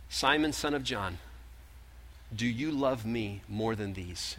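soft, slow, tender asking; gentle question. Acted. Jesus asking Peter by the fire, soft and slow. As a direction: ask it softly, face to face.

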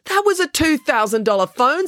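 high-pitched indignant complaint; outraged. Acted. Maya outraged over drowned phone.

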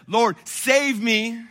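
slow, loud and high plea; desperate cry from the sinking moment. Retold or imitated. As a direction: stretch out the cry for help. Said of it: "in the moment where I said".

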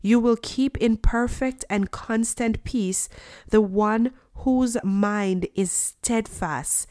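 normal talking voice

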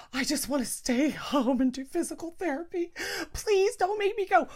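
put-on whiny elderly mom pleading to stay home. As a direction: beg like a reluctant old mother. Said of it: "she was like".

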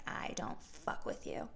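low, hushed, slow threat; menacing whisper. Retold or imitated. Megan glaring at the aggro guy, menacing whisper. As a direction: breathe it like a quiet threat. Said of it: "intense, scary Megan energy".

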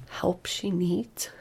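slow, breathless whisper of disbelief; frantic inner whisper. Acted. Mother thinking in panic after the teacher's verdict, whispered. Frantic thought in her head.

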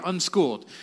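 usual speaking voice

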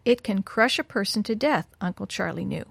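usual speaking voice

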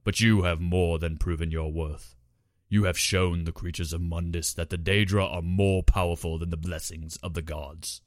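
lightly voiced giant daedra prince; dim slow booming giant. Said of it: "the giant boomed".

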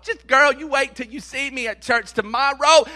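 usual speaking voice

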